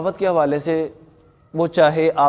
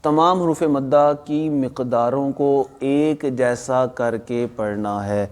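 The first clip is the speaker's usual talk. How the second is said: slow dictation; 4 semitones lower, slower than usual. Shown as a demonstration. Teacher dictating the tajweed rule to students, slow and deliberate. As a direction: slowly spell out the rule word by word. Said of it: "اونچی آواز سے۔".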